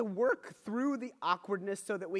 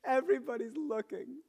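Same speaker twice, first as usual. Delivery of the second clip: higher-pitched imitated child's protest; mock tearful child; 5 semitones higher than usual. Embarrassed daughter protests being watched, imitated tearful complaint. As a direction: play a tearful kid protesting. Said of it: "Seriously, tears are, like, legitimately a part of this".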